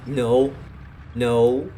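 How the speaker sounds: shouting no. Real, not acted. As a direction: cry out in alarm. Tank hit in battle.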